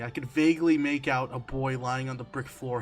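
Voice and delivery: ordinary delivery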